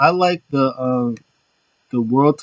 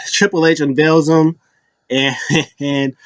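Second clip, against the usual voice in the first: ordinary delivery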